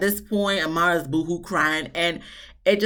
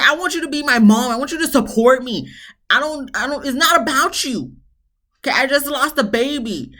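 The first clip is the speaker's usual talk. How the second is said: acted-out pleading exasperation, pitched high; annoyed; 6 semitones higher, 3 dB louder than usual. Imitating a daughter who wants her mom's support, annoyed. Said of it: "Amara's just getting annoyed".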